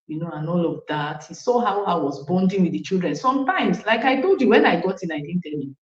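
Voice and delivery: ordinary talk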